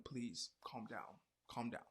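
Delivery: very soft, dropped-down voice; hushed plea. Pretend. Storyteller settling her listeners after the outburst, hushed. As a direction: murmur it soothingly.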